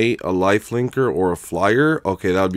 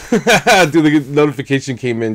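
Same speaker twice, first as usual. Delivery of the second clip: laughing; 6 semitones higher, 8 dB louder than usual. Real, not acted. Laughing at donation alert.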